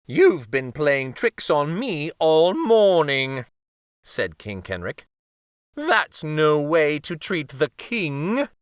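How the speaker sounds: slow, solemn royal rebuke; stern king. A narrator's reading. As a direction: reprimand the uncle. King scolds uncle.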